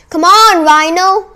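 loud, high urging shout; rallying cry at full voice; yelled cheer. A narrator's reading. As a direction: yell encouragement to your champion. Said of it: "I yelled".

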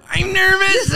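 high, loud put-on voice; exaggerated high-pitched nervous impression; mock dating contestant. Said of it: "and then they go".

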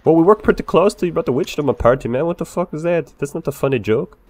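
ordinary talk, nothing marked

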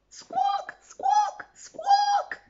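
high parrot squawk. Acted. The captain's parrot squawking a warning. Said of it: "cried the captain's pirate".

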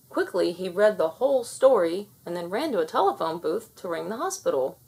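usual speaking voice

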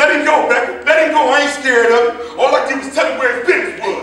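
loud, high, defiant yelling; shouting. Acted. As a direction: shout over the scuffle that you're not scared. Old man shouts during fight.

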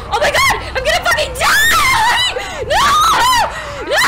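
very high, loud, laughing-through-terror scream; panicked screaming. Real, not acted. Player stuck at a dead end with zombies closing in, screaming in laughing panic. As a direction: shriek half laughing, half crying in real panic.

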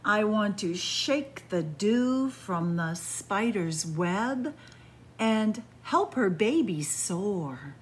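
slow, lilting storybook read; reading to children. Acted. Storyteller reading the windy-day book to kids, lilting. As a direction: savour each image for little listeners.

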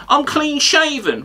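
high, drawn-out parroting of a rival; mocking quote. Comic quoting the pundit's own claim, mocking high drawl. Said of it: "The next one you mentioned is".

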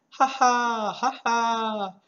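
loud laughing shout from the window; mock laughter. Shown as a demonstration. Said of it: "throw open your window".

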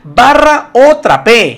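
slow, loud mock command; sweep-or-mop order, stretched and loud; hammed-up bossy spouse giving a choice; household order. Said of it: "Usted, papá".

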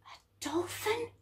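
high, wondering whisper; hardly daring to believe it; awed whisper. A narrator's reading. Astonished discovery in narration.

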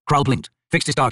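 plain reading voice

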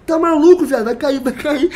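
high, loud frightened yell; panicked shout. Retold or imitated. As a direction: shout that the plane will fall. Panicking during turbulence. (grito apavorado e alto)

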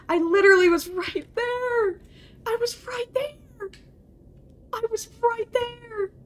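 high-pitched wail of frustration; anguished protest. Real, not acted. Gamer who nearly made it, high anguished protest. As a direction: whine it high in disbelief. Protesting how close they were.

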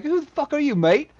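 hammed-up British challenge; imitating an angry Brit. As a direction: bark it like a road-rage bloke. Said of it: "he's like".

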